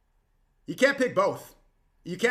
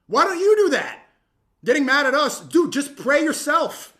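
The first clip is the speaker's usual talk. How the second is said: exasperated outburst; 9 semitones higher, 10 dB louder than usual. Real, not acted. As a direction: shout the challenge, then drag it out.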